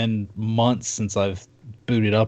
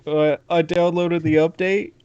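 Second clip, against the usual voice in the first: put-on slower, higher kid voice; sheepish son; 7 semitones higher, slower than usual. Son caught gaming answers his dad, slow sheepish kid voice. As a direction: play it guilty and caught out. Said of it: "he's like".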